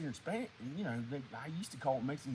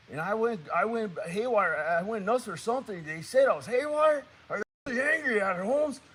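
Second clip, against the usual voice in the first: higher, agitated homeboy storytelling; lightly hammed Mexican character, louder; Mexican guy character; 6 semitones higher, 10 dB louder than usual. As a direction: recount it worked up, put-on Mexican accent. Character recounts breakdown in accent.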